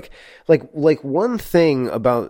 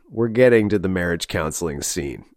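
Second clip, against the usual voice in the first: normal talking voice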